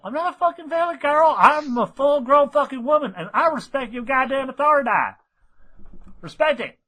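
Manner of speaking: high twangy tomboy pony; Applejack voice. Acted. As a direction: play the cowpony insisting she's a grown woman.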